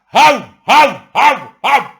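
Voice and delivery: loud, high barks, the same outrage flipped; mock dog barking. Mocking the outcry over revaluation, loud barks. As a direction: yap like a dog again at the other side.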